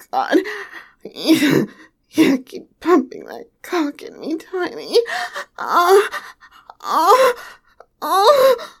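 breathless escalating moans; moaning, building. Acted. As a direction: let the moans build and break. Building toward climax, moaning.

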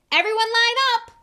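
high, full shout to a crowd; loud mocking announcement; roar. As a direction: bellow it across a courtyard. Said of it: "Malfoy roared to the crowd".

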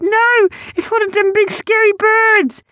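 very high, loud panicky squeal; spooked. A narrator's reading. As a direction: cry out in terror as a small creature. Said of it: "suddenly got spooked".

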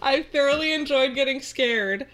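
laughing through the fixed word; laughing as she corrects herself; laughing correction. Real, not acted. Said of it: "getting the fuck off".

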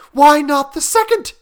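high, loud, frantic question; feverish. A narrator's reading. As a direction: press the point feverishly. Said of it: "said the old woman feverishly".

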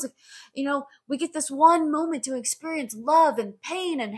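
high-pitched hammed-up wonder; so happy. Meme guy marveling at one lifetime, happy. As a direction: gush on and on in delighted awe. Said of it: "and he's so happy".

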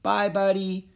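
high, loud farewell call; echoed goodbye. A narrator's reading. Annie echoing Jack's farewell to the horse, high loud call. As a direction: echo the call into the distance. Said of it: "Annie echoed".

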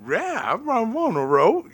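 high, slow, howly dog speech; talking dog. Retold or imitated. Owner voicing his dog's answer about voting, howly dog voice. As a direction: woof the words out like a cartoon dog. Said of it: "And my dog's like".

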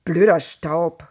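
slow annoyed curse at the dust; annoyed outburst. Acted. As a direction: spit it out, fed up with the dust. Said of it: "ärgerte sich".

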